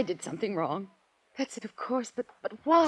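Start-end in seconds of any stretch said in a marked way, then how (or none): 1.4-2.9 breaking out in despair over her brother; pleading cry of a grieving sister; distressed. Acted. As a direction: voice climbing high and louder into anguish.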